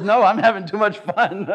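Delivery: high, loud, laughing reply; God laughing. Pretend. God answering his prayer for help, laughing. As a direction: voice God enjoying himself. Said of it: "God says".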